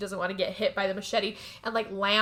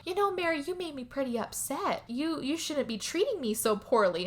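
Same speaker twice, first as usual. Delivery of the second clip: mock reproach; 6 semitones higher, 4 dB quieter than usual. Rose scolds Mary mock-sweetly.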